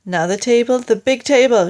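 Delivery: loud ordering call; shouted order. A narrator's reading. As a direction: call the next job out loudly. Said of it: "shouted Mugglewump".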